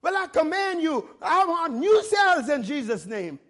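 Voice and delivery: fervent preacher's order; wild faith command. Shown as a demonstration. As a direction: demand it boldly, leaning on the words. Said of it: "You gotta get wild with your faith".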